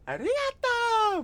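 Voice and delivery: very high, slow squeaky cutesy voice; mock anime voice. Mocking a streamer at a maid cafe, squeaky cutesy voice.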